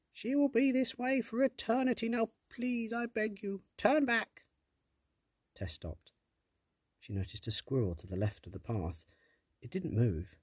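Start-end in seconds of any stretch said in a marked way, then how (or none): 0.1-4.5 very high, begging sprite; pleading fairy voice. Acted. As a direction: beg like a tiny fairy. Sprite begs Tess.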